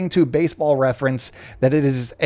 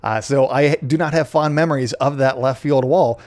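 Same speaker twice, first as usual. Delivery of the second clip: usual speaking voice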